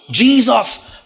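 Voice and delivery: mock crowd cry of Jesus; retold shout. Blind beggar crying out to Jesus, mild mock shout. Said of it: "Bartimaeus shout".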